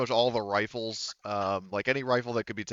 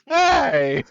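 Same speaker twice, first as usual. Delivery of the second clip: loud, higher indignant "Hey!"; mock offended yell; 13 dB louder than usual. GM voicing the insulted synthetic again, loud mock yell. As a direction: throw out an insulted yelp.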